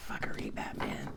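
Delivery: very soft, voiceless whisper; hushed aside to yourself. Real, not acted. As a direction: whisper it under your breath in disbelief. Muttered aside on seeing the cards.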